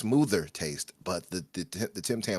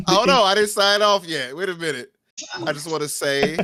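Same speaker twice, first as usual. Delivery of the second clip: laughing; 11 semitones higher, 8 dB louder than usual. Real, not acted. Protests while laughing.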